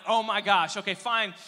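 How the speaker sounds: put-on loud, exasperated teen giving in. Kid caving to mom about weeds, loud and exasperated. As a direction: groan out "oh my gosh" like a teen who gave up.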